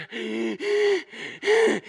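high wheezing gasps, imitated; exaggerated out-of-breath gasps; huffing and puffing. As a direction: mimic a winded runner gasping. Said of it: "huffing and you're puffing".

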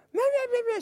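high-pitched nagging gibberish mimicry; mock someone's tirade in a squeaky high voice; mocking yelling. Imitating an angry lady driver yelling at him, high mocking nag. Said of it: "starts yelling at me again".

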